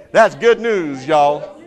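That's good news y'all in a Southern drawl; Southern accent. Shown as a demonstration. Preacher asks the congregation to say it Southern, in a Southern accent. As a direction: say it Southern, loud and high. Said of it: "say it, Southern".

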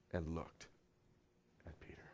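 hushed, slowing climax; fading to a whisper. Acted. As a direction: voice sinks away to a whisper. Dramatic pause in Jesus' look.

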